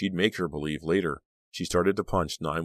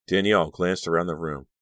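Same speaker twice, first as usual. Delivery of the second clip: usual speaking voice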